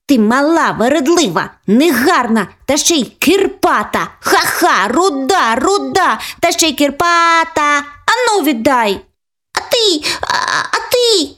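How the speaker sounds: high mocking child voice; taunting little girl. Older sister teasing the redhead, high mocking child voice. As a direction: tease her like a spiteful big sister.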